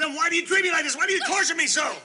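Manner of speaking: high, shouted accusations; angry demand. Acted. Husband accusing his wife of torture, angry shouting. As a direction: hammer her with why.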